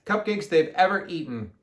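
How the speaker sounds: plain reading voice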